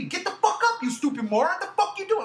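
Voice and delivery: high-pitched retold angry yelling; screaming at TV. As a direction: re-enact cursing out the screen, pitch leaping up. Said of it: "I was literally screaming at my T V".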